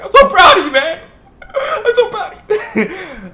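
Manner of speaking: mock-tearful, squealing pride of a proud sensei; a hammed-up high squeal of pride, drawn out slowly at the end; overjoyed teacher. Guy overjoyed at his student.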